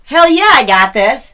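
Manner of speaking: loud, high, proud affirmation; emphatic confidence. Real, not acted. Woman affirming grandfather's faith in her, emphatic. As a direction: declare it boldly.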